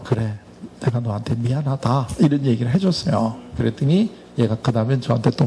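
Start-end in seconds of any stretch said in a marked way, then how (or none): 0.0-2.1 soft fatherly apology. Pretend. Father role hugging student.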